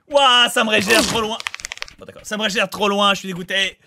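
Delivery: high, loud frustrated complaint; frustrated yelling. Real, not acted. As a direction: rant loudly at the game. Complains about game respawn.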